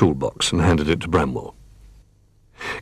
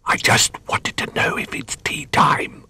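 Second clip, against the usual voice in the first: high, hushed character whisper; 7 semitones higher than usual. A narrator's reading. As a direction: whisper it like a little bear trying not to disturb. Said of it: "whispered Little Bear".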